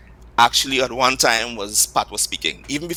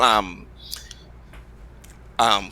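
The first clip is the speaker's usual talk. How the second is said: normal talking voice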